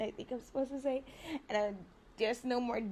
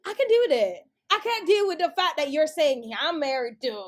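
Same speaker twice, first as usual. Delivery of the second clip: retold outburst; 6 semitones higher, 10 dB louder than usual. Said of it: "I'm just like".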